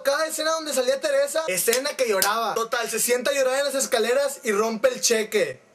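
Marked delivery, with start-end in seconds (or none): none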